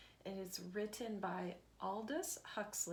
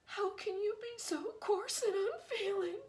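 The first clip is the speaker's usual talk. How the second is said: high, loud outraged wife; hurt outburst at her husband; bursting out; 11 semitones higher, 7 dB louder than usual. Acted. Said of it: "Missus Crow burst out".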